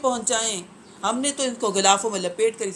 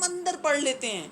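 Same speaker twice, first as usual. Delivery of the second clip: mock excuse; 6 semitones higher, 4 dB quieter than usual. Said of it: "وہ کہہ رہے ہیں".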